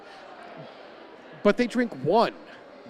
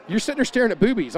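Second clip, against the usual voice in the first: teasing wife; 6 semitones higher, 6 dB louder, faster than usual. Retold or imitated. Wife teasing him, retold.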